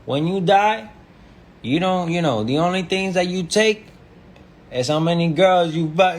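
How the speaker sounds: high, loud impression of an insistent older guy; imitating older man. Fifty-two-year-old giving life advice, mimicked high and loud. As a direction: push the pitch up, mimic a man handing out advice. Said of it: "And he was just like".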